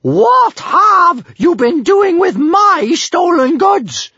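very high, loud outraged shouting; furious scolding. A narrator's reading. Bertha catches her daughter with stolen goods, furious. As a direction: shout the accusation, stretching out words in fury. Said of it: "scolded Big Boobied Bertha furiously".

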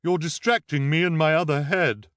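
loud, put-on character voice; disguised voice. A narrator's reading. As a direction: protest in a disguised voice. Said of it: "said in her low, disguised voice".

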